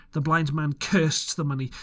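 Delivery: ordinary delivery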